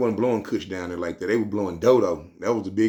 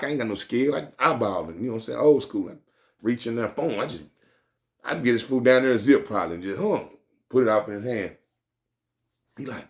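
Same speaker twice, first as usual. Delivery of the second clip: ordinary talk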